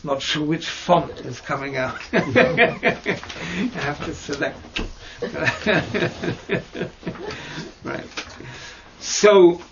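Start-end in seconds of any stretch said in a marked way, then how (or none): 2.3-3.7 real chuckling laugh; amused laugh. Real, not acted. Teacher amused by the chunky font on the board, chuckling. 4.8-7.8 long, real chuckle; amused laugh. Real, not acted. Teacher laughing at their own board writing, long amused chuckle.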